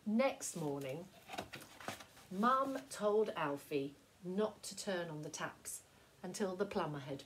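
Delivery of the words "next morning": ordinary delivery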